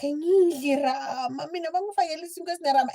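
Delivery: put-on high, crying child's plea; tearful child. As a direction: whimper it like a scared little girl. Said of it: "I want to cry".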